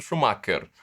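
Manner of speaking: "Schumacher" with a hard k, shu-MA-ker; popular pronunciation. Shown as a demonstration. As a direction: say "Schumacher" with a plain k in the middle. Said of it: "É isso que, é, que você tá fazendo". ("Schumacher" dito "chumáquer", com k)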